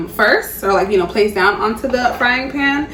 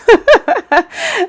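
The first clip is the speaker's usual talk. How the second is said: high delighted laugh; 6 dB louder than usual. Real, not acted. Delighted by the fish.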